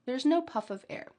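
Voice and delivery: ordinary delivery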